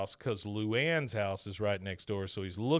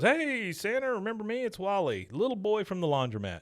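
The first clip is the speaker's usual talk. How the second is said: eager greeting; 9 semitones higher than usual. Retold or imitated. Recapping Wally greeting Santa from the bouncy house, mock eager. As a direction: imitate an over-friendly greeting in a raised pitch.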